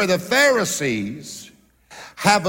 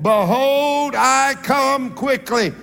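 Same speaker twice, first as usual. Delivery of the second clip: higher, louder, slower proclaiming; pushing harder; 6 semitones higher, 3 dB louder, much slower than usual. Acted. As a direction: lean in and preach it bigger. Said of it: "He pushed out a little further".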